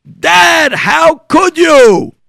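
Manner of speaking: loud, high, drawn-out accusation; angry yell. Acted. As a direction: yell at Dad in outrage. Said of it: "and he yells".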